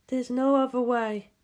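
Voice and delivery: resigned and cool; impassive Ritsuko. Acted.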